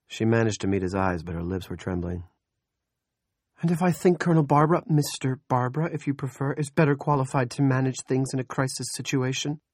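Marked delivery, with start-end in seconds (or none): 3.6-9.6 high, loud, fast pushback; defiant challenge. Acted. Andrea challenging Big Jim, high and loud. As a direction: stand up to him, voice raised.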